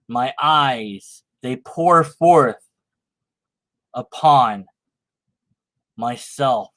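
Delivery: slow, low, overwrought poetry recital; mock-solemn recital of a melodramatic poem; dramatic poem reading. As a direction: ham it up like a tortured poet.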